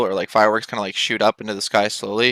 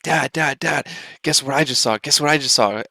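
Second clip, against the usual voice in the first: excited; 2 semitones higher than usual. Retold or imitated. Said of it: "I was so excited".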